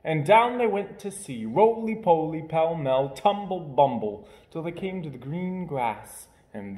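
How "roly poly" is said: plain reading voice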